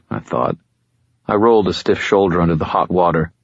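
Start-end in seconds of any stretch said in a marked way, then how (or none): none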